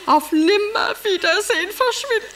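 high, crying lament over the lost lion; tearful lament. Acted. Wife mourning the vanished lion Heinrich, crying in a high voice. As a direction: sob it out, voice breaking.

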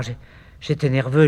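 normal talking voice

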